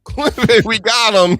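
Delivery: high, loud triumphant yell of clip it; gleeful mock shout of a young troll; excited gloating shout. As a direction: crow it out like you just caught him. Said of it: "Nick is like".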